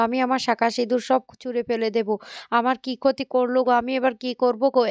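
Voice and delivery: usual speaking voice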